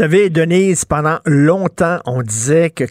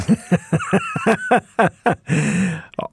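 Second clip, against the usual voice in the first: a short real laugh at the joke, going higher; 6 semitones higher, 3 dB quieter than usual. Real, not acted. A host laughing at the guest's followers joke, amused.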